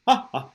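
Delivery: two short bouncing ha's; quick staged belly laugh; demonstrated laugh pops. Shown as a demonstration. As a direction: pop the ha's from the stomach. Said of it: "You feel how your stomach's".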